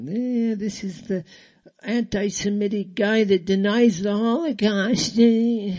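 lightly voiced parody of the critic; mocking impersonation. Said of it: "talking about how".

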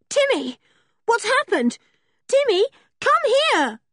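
high-pitched frantic calling; shrieked, panicky shout in a girl's voice. A narrator's reading. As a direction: call out urgently for the missing dog. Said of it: "shouted George at the top of her voice".

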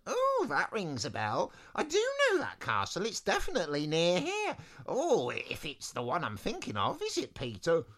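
high, wavering put-on voice; musing wizard with swooping pitch; doddery chatty wizard. First wizard recalls a castle, high musing voice.

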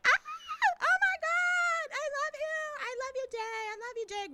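very high, drawn-out imitation scream; mock fangirl squeal. Girls seeing their idols on screen, mock fangirl squeal.

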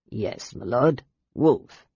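put-on old sailor offering gifts to the knights, gummy and humble; toothless old sailor. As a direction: mumble like a toothless old seaman.

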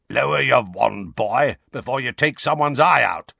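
low, growling gruff warning; growled warning. Acted. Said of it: "said a low, growling voice".

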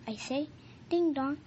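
usual speaking voice

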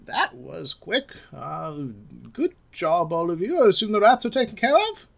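high-pitched tavern keeper voice; Judy. Acted. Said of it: "so Judy ... she says".

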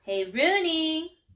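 high, drawn-out playground call. A narrator's reading. Schoolgirl hailing Rooney across the playground, high sing-out call. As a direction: call the name across a distance.

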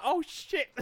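high, loud imitated alarm; hammed-up panic tipping into laughter; laughing mock panic. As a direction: exclaim it while cracking up. Acted panic, breaking into laughter.

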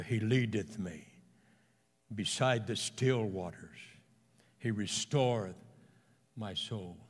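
lightly voiced old preacher, low and soft; weak old pastor. Old pastor quoting the Psalm, lightly imitated weak voice. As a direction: recite softly, a hint of aged weakness, with pauses. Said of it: "His voice was weak from thousands of sermons".

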